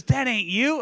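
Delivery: high, loud mimicked child; put-on girl's voice, high and emphatic; little girl. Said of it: "She goes".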